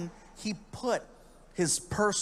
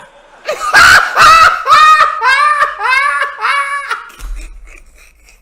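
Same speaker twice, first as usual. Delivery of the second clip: roaring laugh up high; big shout laugh; 19 dB louder than usual. Real, not acted. Comic laughing at his own beach story, roaring laugh. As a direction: burst out laughing loud.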